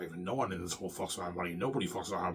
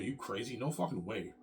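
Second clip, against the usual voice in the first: usual speaking voice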